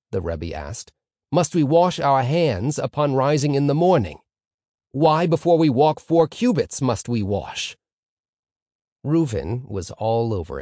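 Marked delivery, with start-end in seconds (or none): 1.3-7.8 higher, louder rabbi addressing a class; questioning rabbi. A narrator's reading. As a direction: put the question like a lecturing rabbi.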